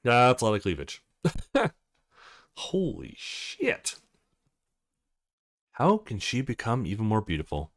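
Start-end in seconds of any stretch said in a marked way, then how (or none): none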